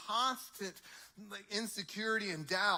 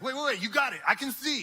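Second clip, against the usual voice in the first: higher, loud exasperated insisting; exasperated outburst; 6 semitones higher, 8 dB louder, faster than usual. Acted. Blind man interrupting the rulers, loud exasperated. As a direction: cut them off, almost shouting.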